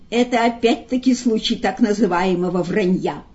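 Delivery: ordinary delivery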